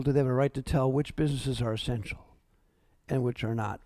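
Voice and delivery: dropped low and soft, almost to himself; quiet. Real, not acted. Pastor hushes the rhetorical question to the congregation, quiet. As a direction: let the question sink to a hush.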